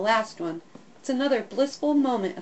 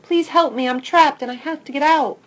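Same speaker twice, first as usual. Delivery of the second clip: loud plea; 5 semitones higher, 7 dB louder than usual. A narrator's reading. Trapped dog pleading.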